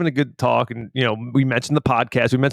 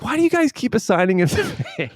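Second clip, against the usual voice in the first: mock whiny ranger; 10 semitones higher than usual. Retold ranger complaining.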